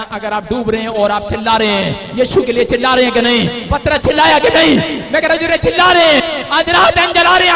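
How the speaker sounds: loud, rising sermon proclamation; fiery pulpit declaration; full-force preaching. Acted. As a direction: preach it out to the whole hall.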